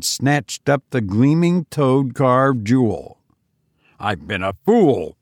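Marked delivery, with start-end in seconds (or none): 4.0-5.2 low-starting, contained raving; raved. A narrator's reading. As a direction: rave at yourself without raising volume. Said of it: "he raved".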